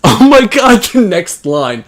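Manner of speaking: oh my god, cracking up and loud; real helpless laughter; laughing. Real, not acted. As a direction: burst out laughing mid-line. Laughing at the next line.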